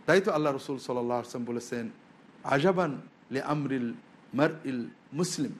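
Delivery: ordinary talk, nothing marked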